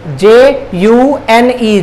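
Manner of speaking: slow, letter by letter; spelling out. Shown as a demonstration. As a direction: spell it out slowly with a gap after each letter. Spelling the month for learners. (एक-एक अक्षर धीरे-धीरे)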